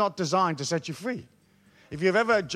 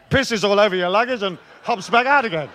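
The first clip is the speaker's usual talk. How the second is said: ordinary talk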